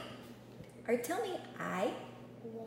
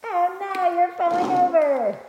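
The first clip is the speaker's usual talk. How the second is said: playful mock alarm; 8 semitones higher, 8 dB louder than usual. Real, not acted. Child tipping over during play.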